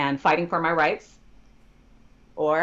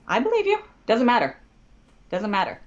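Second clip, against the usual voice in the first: high, emphatic brush-off; insistent dismissal; 6 semitones higher than usual. Retold or imitated. Lawyer to protesting client, insistent and dismissive. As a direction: cut the protest short.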